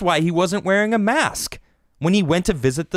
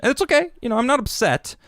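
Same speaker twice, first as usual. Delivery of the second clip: high, bright-faked reassurance; mock cheerful; 8 semitones higher, faster than usual. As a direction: pretend you're totally fine. Feigning not upset.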